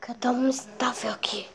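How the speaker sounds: hushed aside; whispering. Real, not acted. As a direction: whisper it fast. Quiet while others sleep.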